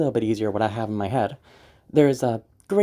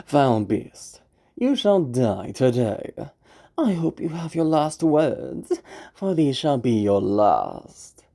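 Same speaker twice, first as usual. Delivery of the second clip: swagger that's hollow inside; false bravado; much slower than usual. Shown as a demonstration. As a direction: overconfident hunter. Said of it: "why don't we see what that type of crumbling".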